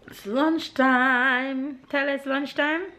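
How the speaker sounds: excited mom announcing lunchtime to her son; sing-song announcement. Real, not acted. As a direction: sing it out to the little one.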